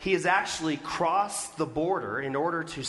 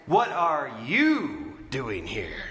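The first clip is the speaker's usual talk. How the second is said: YOU drawn out and raised, slow phrase; stress on YOU; 3 semitones lower, much slower than usual. Shown as a demonstration. Preacher trying another emphasis on God's question, stress on "you". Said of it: "Where's the emphasis in that question?".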